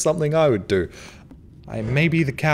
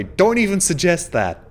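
ordinary talk, nothing marked

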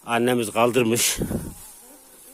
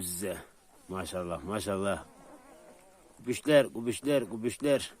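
cooing at puppies; 3 semitones lower, 9 dB quieter than usual. Real, not acted. Shepherd cooing softly over the newborn puppies. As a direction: coo over the little ones gently.

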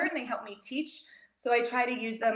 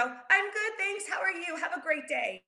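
quick, much higher, breezy brush-off; cheerful automatic answer, high and quick; autopilot reply; 6 semitones higher than usual. Retold or imitated. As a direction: toss it off brightly and keep walking. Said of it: "I would instinctively go".

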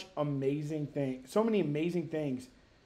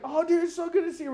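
higher, gushing mock reunion; excited greeting; 10 semitones higher than usual. Two friends reunited at the airport, excited gushing. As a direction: gush like a thrilled friend.

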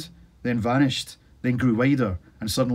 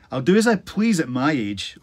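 normal talking voice

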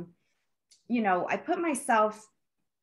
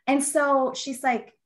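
usual speaking voice

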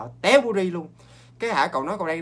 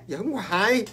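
ordinary talk

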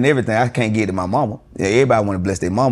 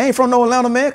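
insistent denial; faster than usual. Retold or imitated. Denying being from Atlanta.

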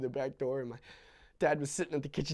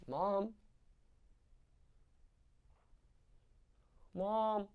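calling softly; 6 semitones higher, 6 dB quieter, much slower than usual. Acted. Child calling dying mother softly.

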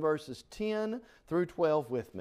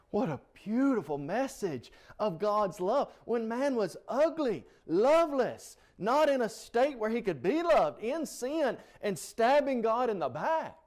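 fervent preaching; 8 semitones higher than usual. Acted. Preacher exalts God's love.

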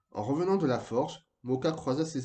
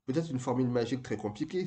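plain reading voice

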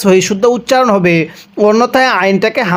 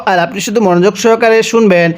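normal talking voice

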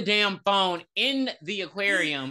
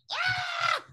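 mock startled shriek. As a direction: squeak like she's been grabbed. Girl startled by forced kiss.

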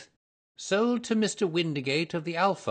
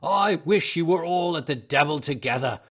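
loud, raised tirade from a stallholder; angry shouting; 6 semitones higher, 11 dB louder than usual. A narrator's reading. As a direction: drive the pest away at full voice. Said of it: "he shouted".

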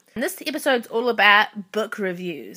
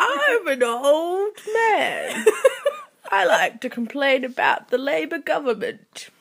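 put-on very old man; 7 semitones higher, 3 dB louder than usual. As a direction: play a grumpy old man griping. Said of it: "Can you do an old man voice?".

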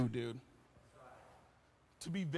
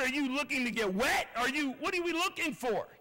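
normal talking voice

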